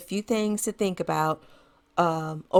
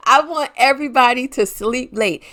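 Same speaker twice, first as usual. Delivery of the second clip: said again through a laugh, louder; laughing repeat; 5 semitones higher, 7 dB louder than usual. Real, not acted. Host to moms, laughing as she repeats. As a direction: chuckle it out as you repeat it.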